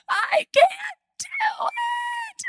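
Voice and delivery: put-on high, breathy sob-whisper of a tired child; whiny crying. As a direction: imitate a worn-out kid whimpering. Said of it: "And she's bawling".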